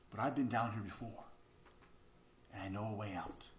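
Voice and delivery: low, soft acted reply; calm reassurance. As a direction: reassure quietly as the friend in the story. Friend calmly reassures trapped man.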